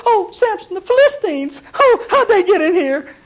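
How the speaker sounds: high, loud mock panic; hammed-up Delilah shrieking in fake surprise; shrill alarm. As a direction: squeal as if caught off guard. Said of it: "And she said".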